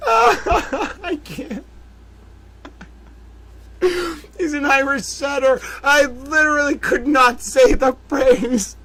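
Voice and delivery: high laughing voice; cracking up. Real, not acted. Host breaking down over his own word mix-up, laughing. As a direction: lose it laughing.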